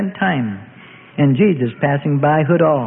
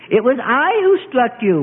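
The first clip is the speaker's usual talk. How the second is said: high, gloating claim; triumphant; 8 semitones higher than usual. A narrator's reading. Attacker owning up to the prisoner, triumphant. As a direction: announce it triumphantly, pitch raised. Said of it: "one triumphantly asserted".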